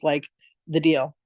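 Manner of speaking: ordinary talk, nothing marked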